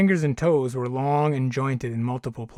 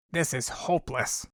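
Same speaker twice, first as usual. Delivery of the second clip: disgusted; 4 dB quieter than usual. Acted. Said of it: "sigh of disgust".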